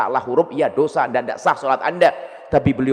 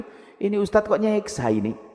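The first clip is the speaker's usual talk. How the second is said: ordinary delivery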